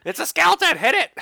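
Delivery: high, loud comic alarm; rally everyone with a high mock-frantic yell; mock panicked shout. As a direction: shout like an excited crowd. Acts out theater crowd attacking skeleton.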